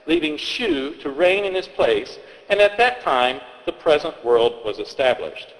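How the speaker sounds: ordinary talk, nothing marked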